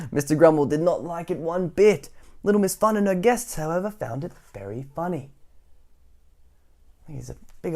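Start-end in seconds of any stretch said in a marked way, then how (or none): 0.1-5.3 louder, animated storybook voice; energetic bedtime-story narration; reading to children. A narrator's reading. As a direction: tell it big for little listeners.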